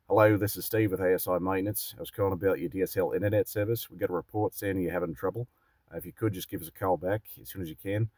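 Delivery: put-on casual Australian-accented voicemail message. Tech rep leaving a customer a voicemail, Australian accent. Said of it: "I just did it in the Australian accent".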